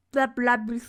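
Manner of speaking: usual speaking voice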